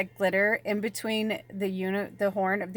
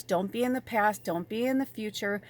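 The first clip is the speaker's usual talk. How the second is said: usual speaking voice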